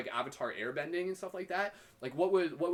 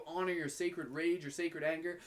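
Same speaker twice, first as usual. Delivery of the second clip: usual speaking voice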